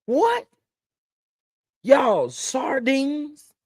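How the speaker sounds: very high, slow incredulous cry; asking the viewers about sardines in total disbelief; stunned disbelief. Real, not acted. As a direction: drag it out in utter shock.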